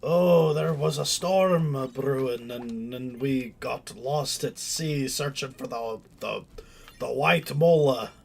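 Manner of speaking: a sea-tale in a hammed-up Scottish-Irish accent; bad Irish-Scottish dwarf accent. Pretending they passed a storm at sea, bad dwarf accent. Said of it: "a bad Irish, Scottish, like, dwarf accent".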